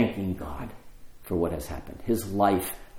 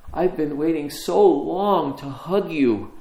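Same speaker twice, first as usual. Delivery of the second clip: slow, gentle words of long-awaited embrace; tender welcome; 4 semitones higher, slower than usual. Retold or imitated. Meredith greets the forgiven man.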